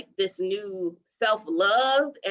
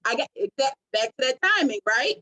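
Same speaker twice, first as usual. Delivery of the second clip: usual speaking voice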